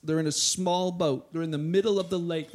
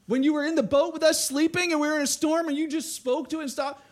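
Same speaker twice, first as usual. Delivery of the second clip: high, loud, exasperated begging; hammed-up urgent appeal to Jesus; mock frantic plea; 7 semitones higher, 4 dB louder than usual. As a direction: demand a rescue in acted desperation. Preacher voices Peter's imagined frantic plea.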